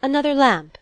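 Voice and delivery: high, loud call to the servant; cried out. A narrator's reading. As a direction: call after someone who is leaving. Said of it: "she cried to the retreating servant".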